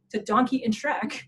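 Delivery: normal talking voice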